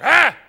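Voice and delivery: short, high, loud ah; demonstrated yell. Shown as a demonstration. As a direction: bark a quick yell over your shoulder. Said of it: "look at him over your shoulder".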